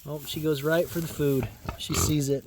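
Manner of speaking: ordinary talk, nothing marked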